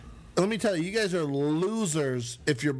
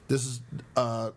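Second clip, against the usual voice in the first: ordinary talk, nothing marked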